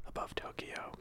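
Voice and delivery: breathy fading whisper. Acted. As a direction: drop to a whisper. Narration drops.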